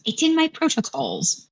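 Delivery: high-pitched droid imitation; put-on high droid voice; Handyman. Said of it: "She imitates Handyman's voice and goes".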